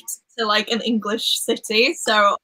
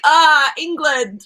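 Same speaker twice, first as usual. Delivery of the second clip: mock realisation; 5 semitones higher, 5 dB louder, much slower than usual. Mimicking people's wrong realisation.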